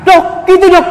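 very high, loud dismayed cry; shocked husband. Acted. Husband crying out to the doctor in shock, high and loud. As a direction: yelp in dismay at the bad news.

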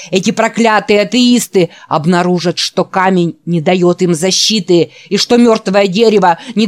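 loud, ecstatic preacher's sermon; fiery preaching. Acted. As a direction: preach it to the rafters. Gardner's sermon on tape. (восторженная проповедь)

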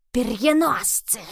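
slow, loud hiss of a warning; hissed accusation, high and drawn out. A narrator's reading. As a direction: hiss the word out, drawing it long. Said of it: "прошипела Лилия".

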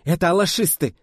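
high, loud cry of disbelief; appalled shout. A narrator's reading. Marina spots the attackers, appalled shout. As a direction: cry out, amazed at such baseness. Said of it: "крикнула Марина, словно изумляясь людской подлости".

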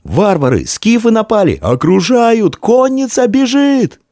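very high-pitched cries of alarm; panicked alarm cry. A narrator's reading. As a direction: raise the alarm across the column. Soldiers raise alarm at attack.

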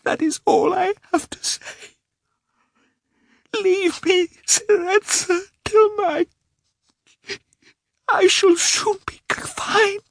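very high, crying plea; tearful plea. A narrator's reading. Wife weeping as she begs her husband.

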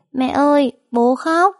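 put-on high, loud small child; excited little boy; child's voice. A narrator's reading. Little boy telling mother father cries, high and loud.

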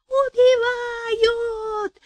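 very high, drawn-out thin wailing cry. A narrator's reading. The mother wailing about murder, very high and drawn out. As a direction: wail like a village mother. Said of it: "тоненько ... заголосила Вера Семёновна".

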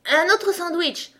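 high, drawn-out, loud request; slow, high-pitched royal demand; demanding king. A narrator's reading. Hungry king asks messenger for another sandwich, demanding.

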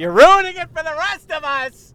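high, loud hammed-up scolding; mock outrage. Angry fan scolding the rowdy pair, high mock yell. Mock quarrel with seat neighbour.

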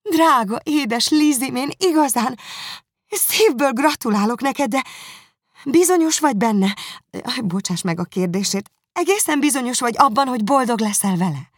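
high, loud delighted sister; gushing congratulations; Jane excited. Acted.

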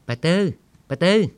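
loud, high call across the yard; shouting a name to stop her; calling out loudly. A narrator's reading. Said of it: "Tư gọi to".

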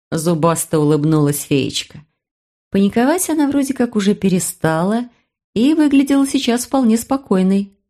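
ordinary delivery

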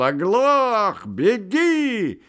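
high furious shout; furious roar. Acted. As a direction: roar at him in fury. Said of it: "он бешено загремел".

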